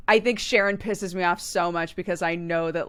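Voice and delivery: ordinary talk, nothing marked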